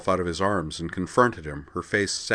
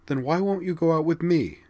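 put-on pleading young woman; 11 semitones higher than usual. A narrator's reading. Vigdis pleading question.

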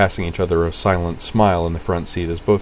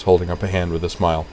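plain reading voice

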